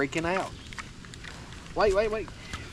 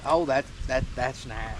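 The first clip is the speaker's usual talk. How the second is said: lightly voiced pig, dropping lower; pig's voice; 6 semitones lower than usual. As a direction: put on a mild pig voice. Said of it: "he said".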